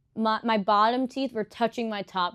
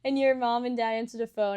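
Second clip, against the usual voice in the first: ordinary talk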